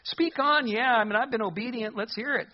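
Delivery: high-pitched, unconcerned go-ahead; breezily unworried. Acted. Saul, not quaking, invites Samuel's message, breezily unworried. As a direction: toss it off high, without any fear. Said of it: "would have thought Saul would start quaking".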